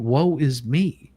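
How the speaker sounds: ordinary delivery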